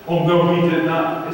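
loud mock protest; indignant objection. Voicing an objector to salvation.

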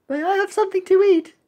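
quick, high-pitched asker; high pleading character voice. Acted. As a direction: plead fast in a squeaky cartoon voice.

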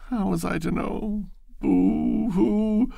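high wail breaking into a sob; boo-hoo, blubbering; crying. Acted. Loretta cries to Bashford, high wailing sob. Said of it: "Loretta was crying".

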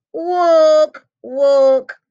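high, loud mocking baby talk; high-pitched baby-talk teasing. A narrator's reading. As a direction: taunt him in a babyish voice. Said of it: "in his baby voice".

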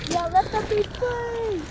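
high, drawn-out cheer; playful cheer. Pretend. Pig cheers tossing rocks.

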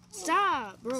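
loud, high alarmed command; urgent shout. Real, not acted. Friend stopping him smashing the Xbox, urgent shout. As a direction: cut in sharply to stop him.

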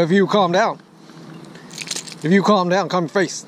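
ordinary talk, nothing marked

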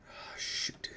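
a real whispered curse; whispered shoot. Real, not acted. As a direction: breathe it out under your breath. Quiet reaction to a setback.

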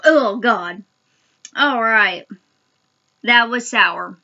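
loud, pained reaction to sour taste. Real, not acted. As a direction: let the sourness hit you out loud.

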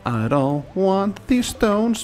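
very high, slow cartoon squeak; high, drawn-out cartoon squeak turning down stones; silly squeaky voice. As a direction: squeak it out like a fussy cartoon critter.

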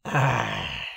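low, drawn-out growled "ah"; growl through teeth. A narrator's reading. Journeyman fed up with the shrieking wife, growling. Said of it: "growled the journeyman between his teeth".

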